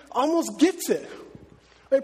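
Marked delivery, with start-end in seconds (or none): none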